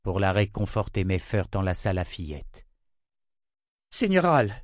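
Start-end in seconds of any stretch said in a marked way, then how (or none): 3.9-4.5 high, loud, commanding call; imperious summons, higher and louder. Acted. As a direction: summon him sharply, no room for refusal. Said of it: "d'un ton impérieux".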